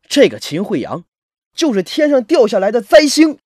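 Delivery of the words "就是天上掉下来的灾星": loud, high-pitched angry declaration; resentful outburst at full voice; bitter outburst. Acted. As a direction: curse him with raised voice and pitch. Narrator curses hated classmate.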